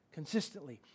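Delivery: "Consistently" almost breathed, very soft; quiet echo. Shown as a demonstration. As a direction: repeat "Consistently" under your breath. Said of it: "read consistently".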